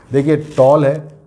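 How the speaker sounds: ordinary talk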